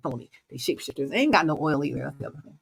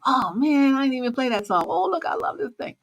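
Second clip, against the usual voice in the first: excited gush; 8 semitones higher than usual. Retold or imitated.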